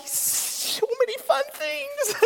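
high, slow, giddy mock voice; giddy yellow. Preacher imitating the yellows, high and giddy. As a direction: squeal it like a delighted kid. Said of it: "the yellows are like".

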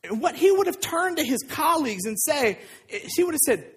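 normal talking voice

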